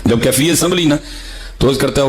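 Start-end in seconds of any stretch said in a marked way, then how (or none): none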